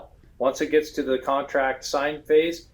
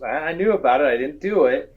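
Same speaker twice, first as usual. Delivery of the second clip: customer's voice. Retold or imitated. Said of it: "and the customer goes".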